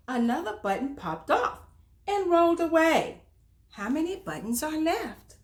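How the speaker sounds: put-on bright, lively read-aloud for little kids; reading to children. A narrator's reading. Storyteller reading aloud to children, animated storytime voice.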